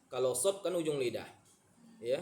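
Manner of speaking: ordinary delivery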